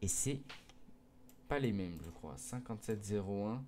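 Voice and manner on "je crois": normal talking voice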